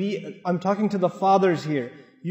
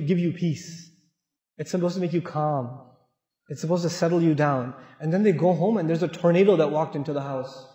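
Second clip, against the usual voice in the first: normal talking voice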